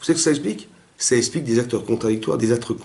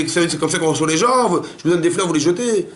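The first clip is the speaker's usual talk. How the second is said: higher-pitched mock outburst; acted indignation; 5 semitones higher, faster than usual. Speaker acting out anger at hosts who threw away flowers, indignant. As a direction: protest like an offended gift-giver. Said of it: "je m'énerve, et je dis".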